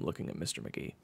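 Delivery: usual speaking voice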